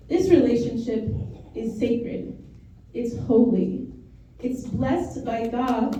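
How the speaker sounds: spaced-out, solemn declaration with long pauses; slow and deliberate. Real, not acted. Ordained priest officially blessing a couple's relationship, slow and deliberate. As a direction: say it slowly, pausing between phrases. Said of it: "I'll say it slowly".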